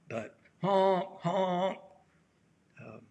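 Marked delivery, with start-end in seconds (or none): 0.1-1.8 high, mock car-horn honks; pitched-up honk honk like a horn. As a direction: play the honking car. Imitating car horns on highway.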